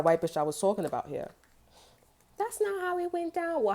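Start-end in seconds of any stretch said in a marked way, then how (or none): 2.4-3.6 much higher, whiny mock of her protest; high mock denial. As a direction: squeak it out as a prissy denial.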